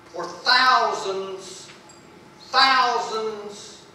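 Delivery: "thousands" drawn out slowly, each one leaned on; emphatic repetition. Real, not acted. Preacher drives home how old marriage is, stressing "thousands". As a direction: hammer "thousands" as a long, weighty word. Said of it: "I want to say it again".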